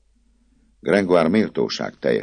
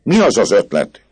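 a louder, angrier repeat of the demand; flaring anger; 12 semitones higher, 12 dB louder than usual. A narrator's reading. Claude repeats his question to the poet, flaring angrily. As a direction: flare up and demand it. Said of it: "ismételte haragra gyúlva Claude".

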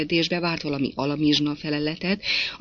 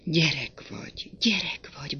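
tender murmur; 3 dB quieter than usual. A narrator's reading. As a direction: soothe a lover in hushed tones. Lover tenderly soothes her. (gyengéd, suttogó hang)

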